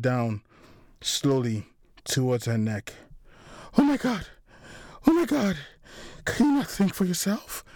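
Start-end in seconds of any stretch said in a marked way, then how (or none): 3.8-7.6 high-pitched panicked woman's voice; desperate pleading. A narrator's reading. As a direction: cry out to the killer in desperation. Victim pleading as knife descends.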